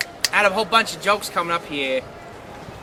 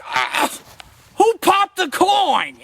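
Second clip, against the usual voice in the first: catchphrase delivery; 8 semitones higher, much slower than usual. Retold or imitated. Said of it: "I'd be like".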